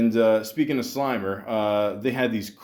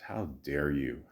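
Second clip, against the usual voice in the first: soft, low mock offence; a quiet how dare you, pretending to be hurt; mock outrage; 7 semitones lower, 8 dB quieter than usual. Mock outrage at mixed reviews.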